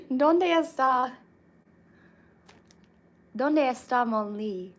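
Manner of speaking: loud playful Spanish. Pretend. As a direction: call out the question with mock Spanish flair. Mock mixed-language sentence.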